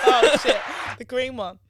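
laughing loud at a mistake. Real, not acted. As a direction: blurt it out through laughter. Pressed the wrong button.

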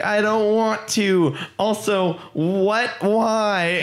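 mock confused orc lady. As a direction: plead in puzzled disbelief. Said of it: "she's like".

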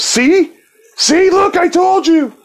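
hammed-up high panicked vindication; shrill excited. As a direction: shriek it like proof just appeared. Guy vindicated by cat.

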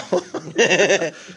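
high, loud real laugh; burst of laughter. Real, not acted. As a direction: crack up at your own story. Laughing at own story.

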